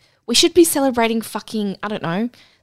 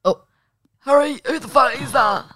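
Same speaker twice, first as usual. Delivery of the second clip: mock lusty gasp; 6 semitones higher, 4 dB louder than usual. Retells seeing the hot man.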